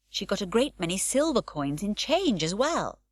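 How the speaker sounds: usual speaking voice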